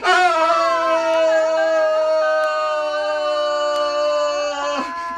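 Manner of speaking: loud, sustained high scream; long falling scream. Acted. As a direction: scream all the way down. Acts out Loki falling.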